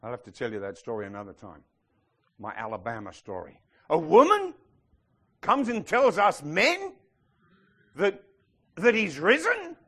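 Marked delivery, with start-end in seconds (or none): 3.9-9.8 high, slow, disbelieving; mock incredulous disciple. Preacher voicing doubting disciples, mock disbelief. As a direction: scoff at the woman's news.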